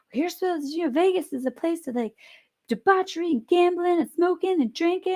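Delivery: high, mocking know-it-all voice; lecturing stranger. Stranger lectures her about Vegas, high mocking voice. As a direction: lampoon the stranger explaining Vegas. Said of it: "People are like".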